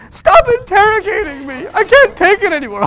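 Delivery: put-on high, loud whiny teen yell; teen meltdown. Teen yelling at mom over a simple question, high and loud. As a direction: wail it like a dramatic teen.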